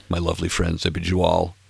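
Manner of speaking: ordinary talk, nothing marked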